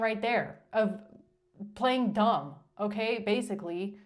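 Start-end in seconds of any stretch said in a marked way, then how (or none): none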